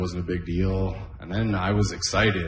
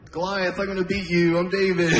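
kid playing; 10 semitones higher than usual. Kid playing with action figures.

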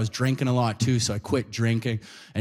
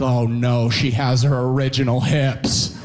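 slow, louder dawning dread; 4 dB louder, slower than usual. Retold or imitated. Comic sees the lady clear the soup cans, slow dread. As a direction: drag it out in mock horror. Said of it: "I'm like".

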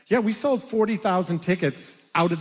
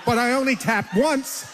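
normal talking voice

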